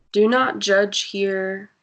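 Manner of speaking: ordinary delivery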